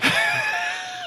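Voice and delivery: shrieking burst of laughter; a high giggling shriek; high squealing laugh. Real, not acted. Laughing at the spoiler.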